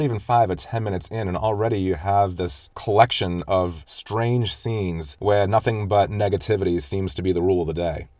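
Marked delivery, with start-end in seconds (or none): none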